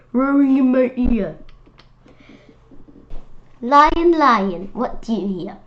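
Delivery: lively, sing-song picture-book read-aloud; reading to children. Acted. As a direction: read it like storytime for little kids.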